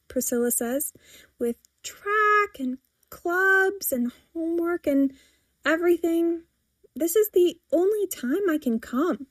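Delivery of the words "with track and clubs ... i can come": put-on teen protest. A narrator's reading. Daughter listing her busy schedule, protesting. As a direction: plead the case item by item. Teen lists excuses to mother.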